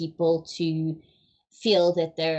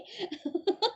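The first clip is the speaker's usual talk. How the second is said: short amused laugh; 10 semitones higher, 4 dB quieter than usual. Real, not acted. Laughs after pun.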